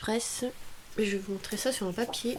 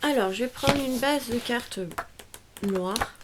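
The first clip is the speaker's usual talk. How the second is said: talking through hiccups; hiccuping between words. Real, not acted. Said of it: "j'ai un peu le hoquet".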